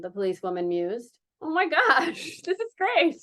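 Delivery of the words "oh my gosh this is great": high, slow delighted gush; delighted squeal. Real, not acted. Reader delighted by the story.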